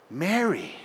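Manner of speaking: quiet tender Mary, falling softly at the end; soft calling of a name. Shown as a demonstration. Pastor voicing Jesus gently calling to grieving Mary, quiet. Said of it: "Do you see how it grew".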